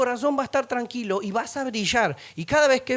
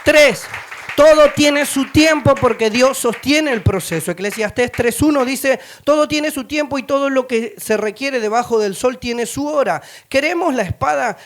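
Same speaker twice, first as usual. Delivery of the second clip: loud, raised-pitch sermon delivery; preaching at full force; 3 semitones higher, 9 dB louder than usual. Real, not acted. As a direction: proclaim the point and verse to the whole room. Announces next point at full force. (predicación enérgica, a viva voz)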